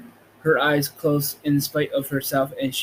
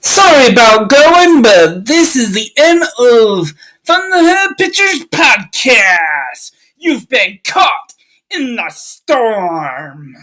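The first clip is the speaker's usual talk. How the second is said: very loud, high, half-crying shout; shouted outro; 8 semitones higher, 12 dB louder, slower than usual. Acted. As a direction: bellow the sign-off like a storm.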